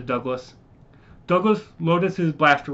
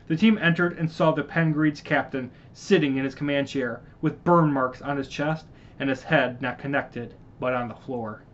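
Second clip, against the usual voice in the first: plain reading voice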